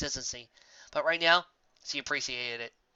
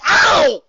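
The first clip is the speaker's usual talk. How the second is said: loud high ow of pain; 14 semitones higher, 17 dB louder than usual. Acted. Hit in the arm.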